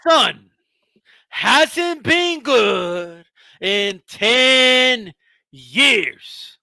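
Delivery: slow mocking shout; drawn-out taunt. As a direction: drag out been good in ten years. Drawn out insult.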